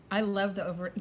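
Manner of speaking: ordinary talk, nothing marked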